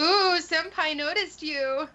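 high, slow teasing squeal; excited anime fan. Acted. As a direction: gush like a fangirl.

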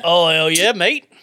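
loud mock English accent; hammed-up British mate. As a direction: punch it out in jokey English. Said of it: "hit me with your best English accent".

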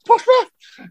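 very high mock-shocked falsetto; dead high voice. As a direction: act flabbergasted in a squeaky high pitch. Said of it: "every voice was dead high".

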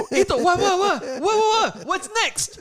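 very high, loud imitation, stammering questions; hyped on adrenaline. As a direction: pester frantically, words tripping over themselves. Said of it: "all hyped up on adrenaline".

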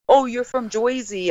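"Jersey" said as Joy-zee; mock Joisey. Guest imitating people's idea of her accent, "Jersey" as Joy-zee. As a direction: mimic outsiders turning "Jersey" into Joy-zee. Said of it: "someone that said Jersey".

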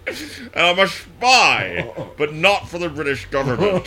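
hammy Connery, mock suave; Sean Connery voice. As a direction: purr it like a Bond villain. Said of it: "Sean Connery".

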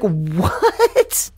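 stunned, confused questioning yelp. Retold or imitated. Teller reacting to a stranger's order, confused. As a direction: blurt it out in baffled disbelief. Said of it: "you know, confused".

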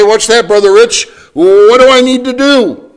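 high, loud startled asking voice; mock a churchgoer calling out; imitating a listener's question. Churchgoer calling to the preacher, high and loud. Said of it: "You say".

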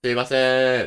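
very loud, sustained call; full-voiced demonstration hail; open-throated projection. Shown as a demonstration. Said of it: "喉を開いてやってるね".

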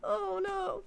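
normal talking voice

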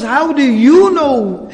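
high, loud and slow, stunned; shocked disbelief. Retold or imitated. Addas stunned that the Prophet knows Yunus, shocked. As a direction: blurt it out in amazement. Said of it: "Addas is shocked".

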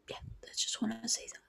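very soft, quick whisper; voiceless, hushed little sign-off; whispered wrap-up. Real, not acted. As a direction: drop to a hurried whisper to finish. Winds up her point in a whisper.

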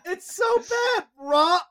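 high, slow, loud disgusted outburst; outraged rant. Real, not acted. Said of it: "outraged about food photo".

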